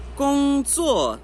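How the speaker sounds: "工作" slow, high level then sharp fall; "工" held high, "作" drops hard; first plus fourth tone. Shown as a demonstration. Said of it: "Primeiro e quarto". ("工作": alto plano, depois caindo forte)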